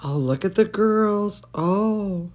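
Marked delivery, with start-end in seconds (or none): none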